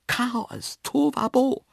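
very high cry of alarm; horrified exclamation. A narrator's reading. Narrator picturing animals running wild, horrified. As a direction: exclaim in high-pitched horror.

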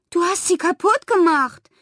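high, loud, stunned accusation; aghast outcry. A narrator's reading. Pippa seeing her puzzle globe smashed, aghast. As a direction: cry out in disbelief at the damage. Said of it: "sagte sie fassungslos".

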